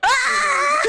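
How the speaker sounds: high laughing yelp; sudden high squeal; startled yelp. Real, not acted. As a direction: yelp in shock mid-laugh. Startled by furry accusation.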